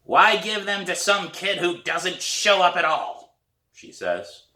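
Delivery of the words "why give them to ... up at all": high, slow dry retort; hoarse old woman. A narrator's reading. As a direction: hand her a tart comeback. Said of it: "It's a hoarse voice".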